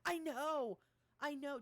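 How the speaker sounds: loud, high embarrassed protest. Real, not acted. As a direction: call it out loud and flustered. Embarrassed, answering teasing.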